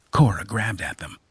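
usual speaking voice

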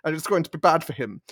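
put-on German-accented character, close to own pitch; comic Germanic accent. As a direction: lean into a staccato Germanic voice. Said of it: "once you start the character's voice".

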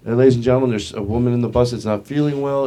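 imitated public announcement; bus driver on PA. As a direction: address the passengers over the PA. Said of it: "the bus driver gets on the P A system".

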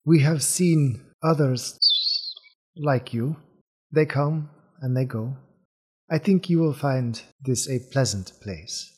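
unhurried bird-man with measured pauses; bird-man character. Acted.

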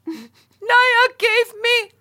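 high, loud, slow wailing sob; imitated crying child. Little girl crying to mom in the car, high slow wail. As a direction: wail it like a hysterical four-year-old. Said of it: "And Stevie's like".

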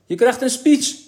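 high, loud excited call; excited schoolboy shout. A narrator's reading. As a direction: crow the news to a classmate. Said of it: "hoorde hij aan alle kanten roepen".

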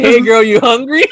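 high, laughing quote; giggling retelling; laughing imitation. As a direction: say it while cracking up.

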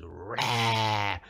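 a short loud mock "Rah!" burst; playful imitated roar; mock outburst roar. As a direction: bark it like a sudden eruption. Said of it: "she just goes".

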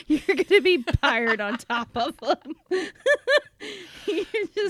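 words broken by loud laughter; laughing. Real, not acted. Laughing through the joke.

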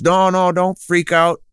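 a mother comforting the shocked father; reassuring mother. Retold or imitated. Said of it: "And she says".

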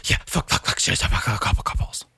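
mostly voiceless rapid-fire swearing; breath-powered string of curses; showing off breath. Shown as a demonstration. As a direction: huff the curses out on one breath. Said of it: "I breathe better. I go".

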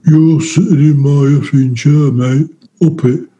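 a hint of a hulking, threatening inmate; lightly voiced brute demanding his seat; menacing inmate. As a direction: loom over him and claim your chair. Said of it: "he leans right over me and goes".

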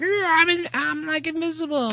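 high, strained voice of an invisible villain; mock movie villain. Recalling a movie villain for the co-host, mock villain voice. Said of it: "where he's like".

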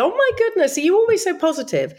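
very high mimicry of amazed fans; gushing admirers. Everyone remarking how positive she is, mock gushing. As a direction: imitate someone marvelling at you. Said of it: "everyone used to say".

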